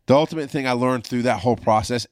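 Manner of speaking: usual speaking voice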